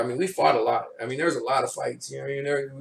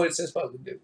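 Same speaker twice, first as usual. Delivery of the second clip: normal talking voice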